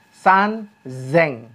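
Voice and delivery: "san zeng" slowly, with z in place of s; slow "san zeng", buzzing the z; voiced sound change. Shown as a demonstration. Teacher showing the changed thousand, slow voiced z in zeng. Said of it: "ada perubahan sedikit nih".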